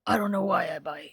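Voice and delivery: lightly gruff character voice. Acted. Streamer demonstrating the original quote's voice, lightly gruff. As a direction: put a touch of gruffness on the quote. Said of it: "It's like a gruff voice".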